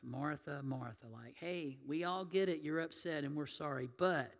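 soft, gentle calling of her name; tender repetition. Retold or imitated. Jesus gently calming upset Martha, soft and tender. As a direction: soothe her softly, lowering the voice. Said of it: "tender kind of repetition".

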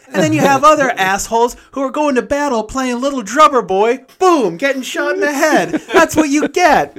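high, loud mock rant about drummer boys; mocking rant as dad. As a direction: keep the dad's sneering tirade going. Dad's rant about old war films.

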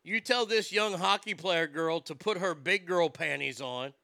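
normal talking voice

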